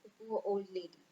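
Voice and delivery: storyteller reading a sad nursery poem; poem recitation. Acted. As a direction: recite it for children, pausing between lines.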